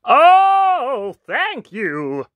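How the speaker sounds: high, loud gushing thanks; big high "oh" easing into thanks; grateful prince's cry. A narrator's reading. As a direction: cry out with swooping gratitude. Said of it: "the prince cried".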